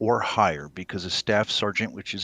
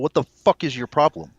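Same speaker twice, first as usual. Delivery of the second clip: loud, higher, furious dressing-down; snapping captain; 6 semitones higher, 8 dB louder than usual. Acted. Fake captain yelling at the gate guard, furious. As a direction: tear into the corporal for not saluting. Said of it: "as soon as you snap at him".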